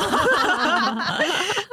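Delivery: high, squealing burst of laughter; helpless laughter. Real, not acted. Mother laughing helplessly at her daughter's story, high and squealing. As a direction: break up laughing at the punchline.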